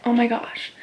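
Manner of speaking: breathy whispered oh my gosh; hushed, defeated whisper; whispered dismay. Retold or imitated. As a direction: drop to a whisper in disbelief. Quiet dismay on the phone.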